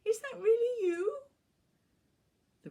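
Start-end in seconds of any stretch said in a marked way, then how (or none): 0.1-1.2 high, loud, excited squeal. A narrator's reading. Owner hearing her dog speak, squealing high. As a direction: squeal it in delighted disbelief. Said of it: "I squealed".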